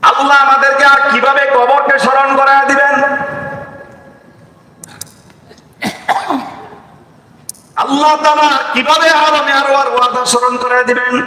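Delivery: high, impassioned preacher's cry; fervent preaching. Acted. Preacher to the congregation about prayer, fervent high preaching. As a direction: lift the voice and preach to the crowd.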